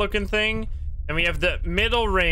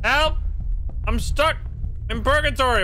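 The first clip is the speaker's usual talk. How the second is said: high hammed-up call for help; mock cry for help; 9 semitones higher than usual. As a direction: cry out as if trapped. Calling out in game.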